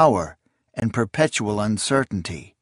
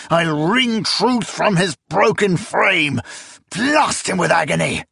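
furious vow; 7 semitones higher than usual. A narrator's reading. Emperor vowing torture in rage.

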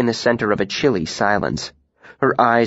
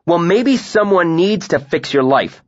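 raised, sharp angry retort; a higher, cutting snap; snapped; 7 semitones higher, 3 dB louder than usual. A narrator's reading. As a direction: bite back at her. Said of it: "I snapped".

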